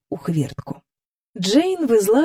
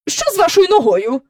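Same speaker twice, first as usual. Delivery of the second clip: unkindly; 9 semitones higher, 4 dB louder than usual. A narrator's reading. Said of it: "неласкаво спитала".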